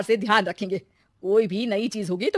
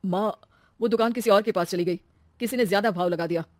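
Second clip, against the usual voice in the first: dejected son; 3 dB louder than usual. Acted. Son telling mother the shop is gone, mildly dejected. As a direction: break bad news glumly. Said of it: "Rajesh बहुत दुखी होकर".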